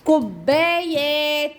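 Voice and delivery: drawn out كوبايات in a slow singsong; slow sing-song. Shown as a demonstration. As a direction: sing كوبايات slowly to a child. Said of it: "modelling the plural".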